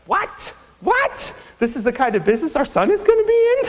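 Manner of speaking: mock-appalled parents, rising sharply; shrill-toned mock outrage of mom and dad; incredulous parents. Parents appalled at his job.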